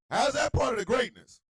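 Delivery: high, loud demanding question; straight-faced loud demand; indignant shout. Real, not acted. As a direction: press the point without laughing. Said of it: "repeated take".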